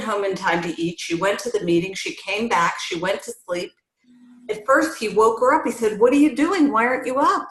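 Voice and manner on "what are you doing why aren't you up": husband's demand, pitched up and louder; mock angry husband. Husband demanding answers from his wife in the morning, mildly angry. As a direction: play the irritated husband waking her.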